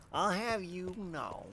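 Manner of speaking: slow, soft smug nerd drawl. Shown as a demonstration. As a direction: draw it out like a pompous nerd.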